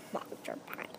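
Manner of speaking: silent unvoiced whisper. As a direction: barely breathe the name. Child whispers the name.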